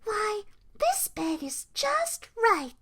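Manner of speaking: put-on high, slow little-girl voice; contented Goldilocks. A narrator's reading. Goldilocks settling into Baby Bear's bed, contented. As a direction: sigh it out high and satisfied.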